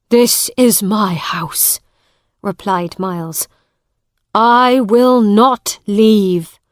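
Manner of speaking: loud, slow defiance; defiant. A narrator's reading. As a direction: plant your feet and refuse. Miles defies Hugh.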